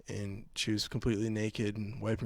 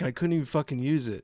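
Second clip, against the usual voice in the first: ordinary talk, nothing marked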